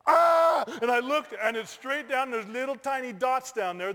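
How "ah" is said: loud, high scream of shock; acted-out yell of alarm; terrified scream. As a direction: scream at the sudden open drop. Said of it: "I just went".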